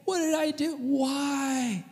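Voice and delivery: soft, high hurt plea; a small betrayed question, quiet and high; hurt pleading. Retold or imitated. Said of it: "he's just like".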